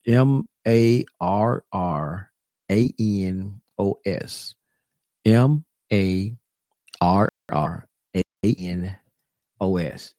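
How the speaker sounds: each letter named slowly and separately; spelling it out. Shown as a demonstration. Teaching the brothers and sisters the spelling, slow letters. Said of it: "this is how you spell".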